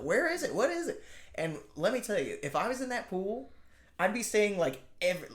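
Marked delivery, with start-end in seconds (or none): 0.0-1.0 much higher alarmed asking; high frantic questions. Retold or imitated. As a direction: call out in panic, high. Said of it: "her friends kept having to ask".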